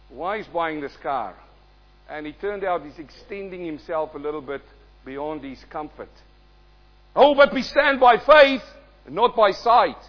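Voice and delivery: ordinary talk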